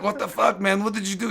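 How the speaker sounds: high, softened mock complaint; retold dazed protest. Coach protests after being choked out, high soft mock complaint. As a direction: protest in a high, small imitated voice.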